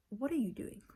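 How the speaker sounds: firm confrontation. Retold or imitated. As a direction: stare them down and ask it. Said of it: "that's all I say".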